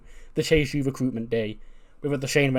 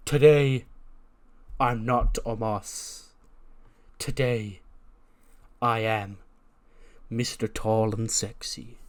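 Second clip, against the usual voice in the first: slow, drawn-out announcement with pauses; grand slow reveal; slower than usual. Retold or imitated. Omos revealing his new persona to Carmelo, slow. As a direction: unveil the new name like a showman.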